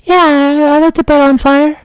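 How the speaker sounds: put-on high kid fibbing to firemen; little kid voice. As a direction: play dumb like a small child. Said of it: "And I was like".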